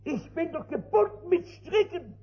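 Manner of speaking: high, loud cry of helplessness; despairing drunkard. Retold or imitated. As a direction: wail that he is bound. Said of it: "sagt der Trinker zu dem Stadtmissionar". (der Trinker klagt dem Missionar verzweifelt, hoch und laut)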